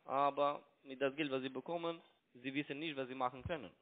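ordinary delivery

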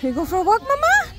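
sing-song to the little dog. Real, not acted. Grandma asks her Chihuahua about the walk in high baby talk.

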